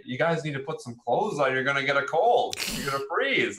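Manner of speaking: mock an elder's worried scolding with lifted pitch; old couple. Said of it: "this old couple, like, walk in".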